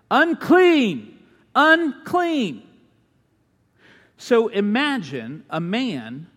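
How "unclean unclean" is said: high, loud warning cry; leper's yell. Retold or imitated. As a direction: call it out so the crowd scatters. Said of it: "they would have to yell".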